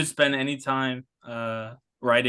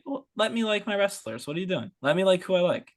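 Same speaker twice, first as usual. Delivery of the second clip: exasperated; 7 semitones higher than usual. Retold or imitated. Retold exasperated reply to fans.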